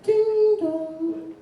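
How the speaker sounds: high sung doorbell chime. Acted. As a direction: sing the two doorbell notes up high. Doorbell at the plumber's visit.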